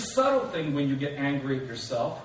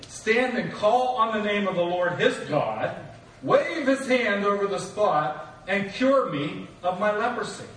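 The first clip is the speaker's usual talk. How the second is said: Naaman angry; 5 semitones higher, 5 dB louder than usual. Retold or imitated. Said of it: "Naaman went away angry".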